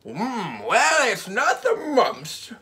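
doctor's voice. Acted.

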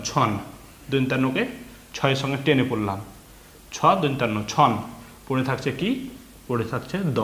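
ordinary talk

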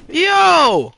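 high, loud sudden yo; excited yell. Real, not acted. Gamer hyped by a big play in the match, loud yell.

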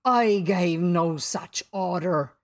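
slow, loud captain with slight unease; slightly shaken Hook. A narrator's reading. Startled captain denies the order, slightly shaken. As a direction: deny it slowly and firmly. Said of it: "shaking a little".

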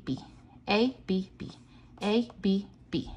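ordinary delivery

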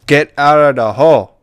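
shouting. Real, not acted. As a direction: yell it at the animal. Yelling at the pig.

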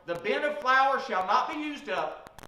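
normal talking voice

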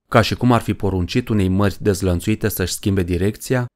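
ordinary delivery

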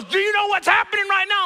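high, fast mock outrage; hammed-up indignant rant; going off. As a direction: imitate a riled-up fan laying into someone. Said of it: "the guy went off".

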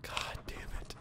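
cursing under breath; whispered curse. Real, not acted. Gamer whispering a curse in frustration. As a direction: hiss it quietly.

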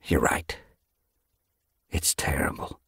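hushed, regretful admission; genuine regret. A narrator's reading. Leader admitting the death is terrible, quiet regret. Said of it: "genuine regret in his voice".

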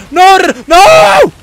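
high, loud cry of no; panicked shout. Real, not acted. Player protesting at the game, panicked shout. Panicked play as things go wrong.

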